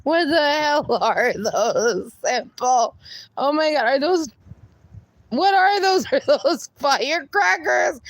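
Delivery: rising shock with laughter; shocked laughing. Real, not acted. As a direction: react in amused disbelief. Streamer reacts to firecrackers.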